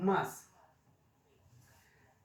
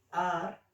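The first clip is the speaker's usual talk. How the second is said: "Ar" stressed loudly on its own; sílaba tónica; 3 semitones higher, 5 dB louder than usual. Shown as a demonstration. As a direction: hit "Ar" hard as the strong syllable. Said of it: "es la sílaba donde recae la fuerza". ("Ar" con fuerza, como sílaba tónica)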